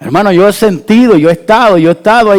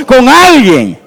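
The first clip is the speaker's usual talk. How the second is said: call and response; 8 semitones higher, 6 dB louder, slower than usual. Real, not acted. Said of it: "Diga conmigo".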